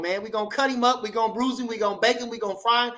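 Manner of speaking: usual speaking voice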